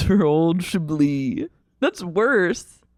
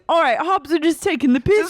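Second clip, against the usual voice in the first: mock Cockney; 10 semitones higher than usual. Continuing Charlotte's Cockney bit.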